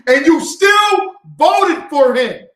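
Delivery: loud, slow, the word still hammered; furious shout. Real, not acted. Scolding voters who still voted, furious shout. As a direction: shout the disbelief at them. Angry rant about voting.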